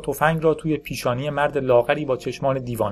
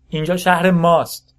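slow, high, loud claim; defiant shout; 6 semitones higher, 6 dB louder than usual. A narrator's reading. Captive gang boss to his captor, defiant shout. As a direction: raise it high and stretch the words.

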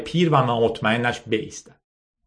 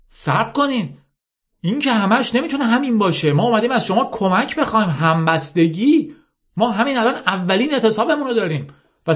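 higher, urgent appeal; pleading young man; 10 semitones higher than usual. Acted. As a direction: beg them to wait and listen. Ashok pleads for help.

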